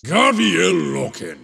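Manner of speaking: ordinary talk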